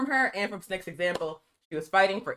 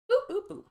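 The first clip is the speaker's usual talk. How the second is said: high sing-song boops; playful high boop boop boop; typing noises; 13 semitones higher than usual. Real, not acted. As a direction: chirp it like a little typing sound. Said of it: "Can't type it".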